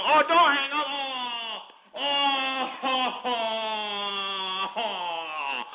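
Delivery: long drawn-out wail, starting high and sinking away; hammed-up lament trailing down; mock dismay. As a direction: taunt with a stretched, fading "oh". Mocks caller who hung up.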